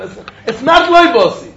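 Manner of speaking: normal talking voice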